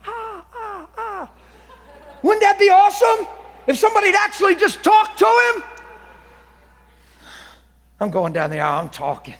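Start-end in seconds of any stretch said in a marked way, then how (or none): 0.1-1.3 joyful cry. Real, not acted. Heart filled with a song. 2.2-5.6 high, loud preaching cry; preaching shout. Real, not acted. Preacher urging the congregation to talk to Jesus, shouting.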